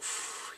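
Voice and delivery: voiceless puff of breath; imitated exhale. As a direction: blow out air softly in imitation. Imitates man's exhaled reaction.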